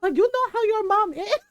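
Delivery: high-pitched auntie reproach; retold exasperated scolding. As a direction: imitate an auntie scolding in disbelief. Tita scolds for telling.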